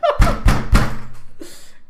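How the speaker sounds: high, loud burst of gloating laughter; gleeful loud laugh. Real, not acted. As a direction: cackle with triumph. Gloating over ex. (ज़ोरदार, ऊँची ठहाकेदार हँसी)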